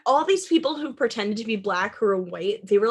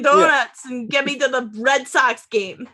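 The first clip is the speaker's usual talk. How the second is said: mock Boston accent; 4 semitones higher than usual. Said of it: "They just switched to Boston accents".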